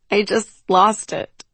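trying to talk through laughter; amused confession, on the edge of laughing; cracking up. A narrator's reading. Freya admits to her sister she lost her voice, cracking up. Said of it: "cracking up".

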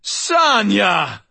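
long, loud howl of a name. Acted. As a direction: howl across the yard. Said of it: "I howled".

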